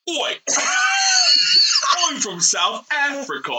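put-on laughing in a British accent; British voice with a burst of laughter. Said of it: "my impression of a South African".

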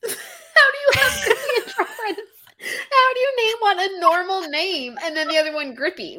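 high, loud words squeezed out through laughter; real laughing disbelief; cracking up over the names; laughing hard. Real, not acted.

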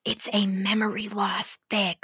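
hushed secret whisper. Real, not acted. To viewers about the fic, a secretive whisper.

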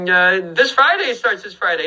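normal talking voice

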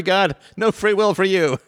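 high, slow mock ruling; mock decree. Mocking Spinoza by voicing his sentence on God. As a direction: hand down the verdict with a teasing grandeur.

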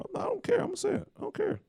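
squeaking through laughter; high, breathless, laughing it out. Real, not acted.

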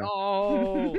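shouted no with a laugh; loud no. Real, not acted. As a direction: refuse loudly. Refusing phone number joke.

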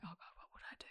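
panicked whisper, acted out; whispered self-doubt. Teacher privately stumped by a student's question, mock-panicked whisper. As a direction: whisper it like a secret worry.

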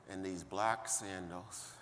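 usual speaking voice

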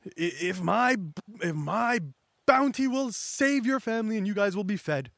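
T-Bone. Acted. T-Bone offering his bounty to a hungry family, lightly acted appeal. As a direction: plead a little, as if offering yourself up. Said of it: "imagine T-Bone being like".

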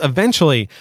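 ordinary talk, nothing marked

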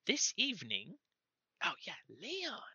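high, halting gnome voice; squeaky gnome messenger. Acted. Gnome passing on a contact's message, high and halting. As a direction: pipe it up carefully as a little gnome.

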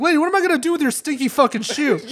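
high, loud exasperated retort; laugh-edged, throwing it back at her; incredulous loud comeback. Retold or imitated.

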